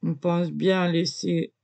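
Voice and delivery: ordinary delivery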